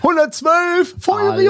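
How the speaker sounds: high, excited call-out; alarm shout. Acted. Calls out emergency number. (hoher Alarmruf)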